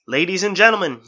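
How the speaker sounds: high, loud address to the stadium; carrying voice. Acted. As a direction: project it to the whole arena. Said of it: "His voice carried through the silent stadium".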